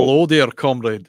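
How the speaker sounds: loud, hammed-up Russian captain in his own accent; Sean Connery. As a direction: make no attempt at a Russian accent. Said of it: "He was like".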